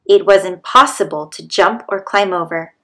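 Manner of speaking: ordinary delivery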